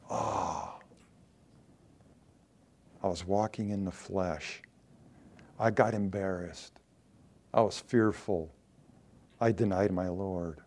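a hint of weeping remorse; sorrowful realization. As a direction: Peter softly confessing his failure with regret. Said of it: "he wept".

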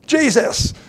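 calling his name high and loud in alarm; frantic high call of Jesus, loud; loud alarmed cry. Shown as a demonstration. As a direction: shout it like panicked disciples waking him. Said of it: "so you could hear the way they talked".

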